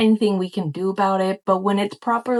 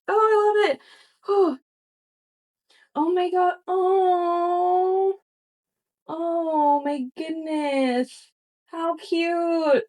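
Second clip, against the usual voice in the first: high, breathy coos of delight; gushing over a gift from a friend; high gushing awe; 9 semitones higher, much slower than usual. Real, not acted. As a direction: coo like seeing a kitten. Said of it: "I'm freaking out".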